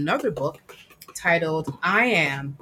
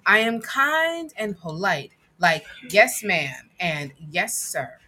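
put-on reading to children; 4 semitones higher than usual. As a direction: read it proudly to a child. Reads picture book aloud.

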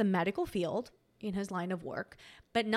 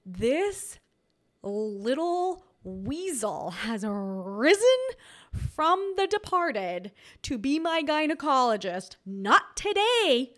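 slow, louder, drawn-out dramatic defiance; bold defiance; 3 semitones higher, 6 dB louder, much slower than usual. Retold or imitated. Feeling bold, inwardly defying her ex turned gynecologist, slow and louder. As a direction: spit it out like a grand dramatic declaration. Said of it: "I was feeling so bold".

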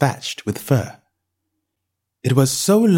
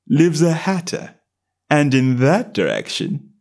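lazy cat voice; 4 semitones higher, slower than usual. A narrator's reading. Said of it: "the cat said".

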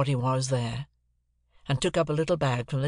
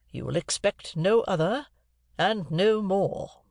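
slow, higher, weighted phrase by phrase; distinct, emphatic; 5 semitones higher, slower than usual. A narrator's reading. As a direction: make every word count, firmly. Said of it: "in a distinct, emphatic voice".

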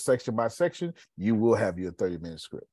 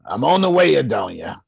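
calling out; 3 semitones higher, 8 dB louder than usual. Real, not acted. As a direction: call out to her cheerfully. Calls out to Adonia.

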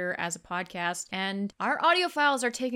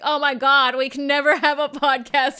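loud, raised mock-horrified outburst; retold dismay; 5 semitones higher, 8 dB louder than usual. Cohost recalling their reaction to an awful first recording, loud dismay. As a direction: cry out in exaggerated despair at yourselves.

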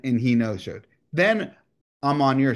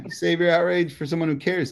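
normal talking voice

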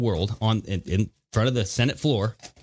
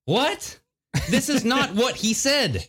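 incredulous; 11 semitones higher, slower than usual. Real, not acted. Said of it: "disbelief at the clip".

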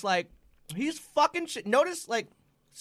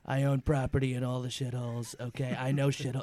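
Trump impression; 6 semitones lower than usual. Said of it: "continues Trump voice".

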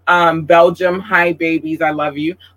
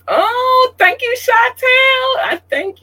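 much higher, slow, squealing with delight; thrilled squeal; slower than usual. Real, not acted. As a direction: gush over a loyal fan by name. Spots a fan's name, thrilled.

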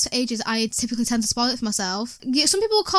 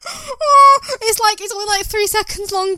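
high, loud tearful cry; real tearful outburst, much higher; 9 semitones higher, 6 dB louder than usual. Real, not acted. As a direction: wail the oh. Upset over TV death.

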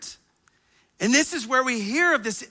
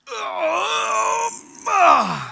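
dad's groan; 12 semitones higher than usual. Retold or imitated. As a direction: imitate an old man's pained groan while bending. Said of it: "and it was that".

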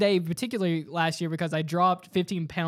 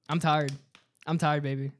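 ordinary talk, nothing marked

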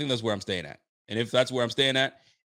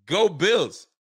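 higher, louder call across the street; mock stranger's shout; 7 semitones higher, 4 dB louder, much slower than usual. Stranger spotting the shirt yells a loud call. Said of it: "and it goes".

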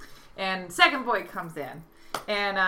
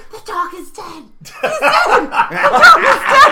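imitated high shouting, building louder; girlfriend blurting out; 16 dB louder than usual. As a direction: mimic an insistent heckler, half laughing. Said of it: "she's in the background just going".

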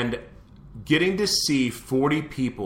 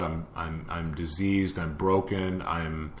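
quiet self-doubt; 6 semitones lower, 8 dB quieter, slower than usual. Real, not acted. Confessing his inner doubts to the audience, low and hushed. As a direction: lower and softer, halting over each word.

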